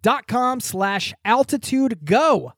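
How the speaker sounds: upbeat sponsor read, high and bright. Acted. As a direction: read it like a radio ad.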